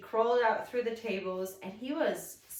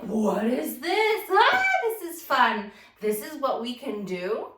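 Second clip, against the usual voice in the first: imitating the kid's wonder; 3 semitones higher, 8 dB louder than usual. The kid discovering play, louder and higher with wonder. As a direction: voice a toddler's thrilled amazement. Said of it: "He's like".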